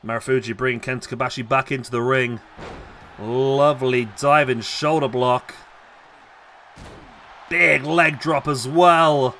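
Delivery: lively ringside wrestling call; wrestling commentator calling the match live; live play-by-play. Acted. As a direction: call every move as it lands.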